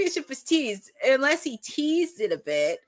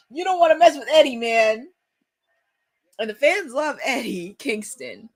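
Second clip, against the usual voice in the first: normal talking voice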